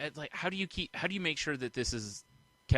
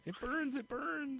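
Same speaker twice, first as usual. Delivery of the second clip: high, small falsetto wail; mock burning victim; 6 dB quieter, slower than usual. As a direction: play a victim wailing softly in falsetto. Plays victims of dropped moonshine.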